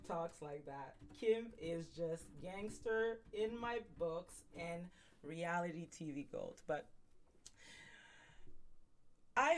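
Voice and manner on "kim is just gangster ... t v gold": ordinary talk, nothing marked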